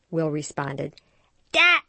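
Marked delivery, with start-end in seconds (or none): 1.5-1.8 put-on high, loud insistent baby yell; emphatic toddler shout. A narrator's reading. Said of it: "even more emphatically".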